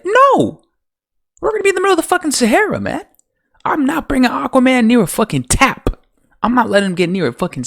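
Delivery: high-pitched, incredulous outburst; indignant comic tirade, voice shooting up; outraged rant. Real, not acted. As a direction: explode with disbelief at the nonsense. Ranting at illogical plot writing.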